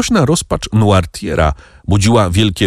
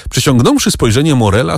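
ordinary delivery, nothing marked

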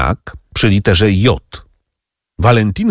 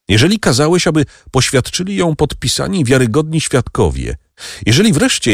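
pleading; 7 semitones higher than usual. A narrator's reading. Franz pleads with Noirtier.

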